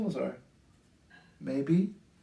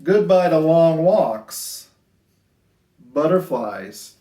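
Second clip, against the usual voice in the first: sing-song rhyming storybook read; lilting picture-book voice; reading to children; 11 dB louder, slower than usual. A narrator's reading. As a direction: read it gently to a class of little kids.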